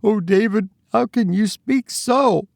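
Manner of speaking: very high, tearful wife's reproach; high-pitched, plaintive sob; sobbing. A narrator's reading. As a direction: wail it reproachfully at your husband. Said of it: "sobbed his wife".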